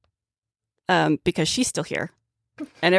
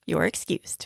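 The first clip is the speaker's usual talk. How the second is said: ordinary delivery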